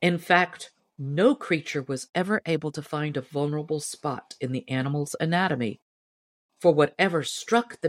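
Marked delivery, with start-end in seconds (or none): none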